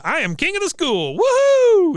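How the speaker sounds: high, loud victory whoop; mock triumphant whoop from the podium; triumphant shout. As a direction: crow it out like a winner. Peggy's triumphant shout retold.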